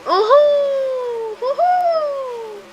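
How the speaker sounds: high, slow gleeful whoop; drawn-out whoop. Real, not acted. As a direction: stretch the whoop out high with delight.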